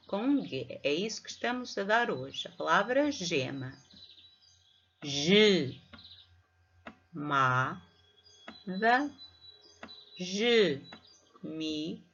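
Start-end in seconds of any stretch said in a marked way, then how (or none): 5.0-12.0 each syllable held and set apart by pauses; divisão silábica. Shown as a demonstration. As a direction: read it out very slowly, one syllable at a time. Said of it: "Estou também a fazer a divisão silábica". (leitura pausada, sílaba a sílaba)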